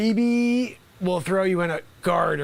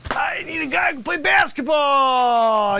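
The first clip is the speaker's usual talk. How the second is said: frustrated coach yelling, slower; exasperated shout; 5 semitones higher, 3 dB louder than usual. Acted. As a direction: bark it out, fed up with the player.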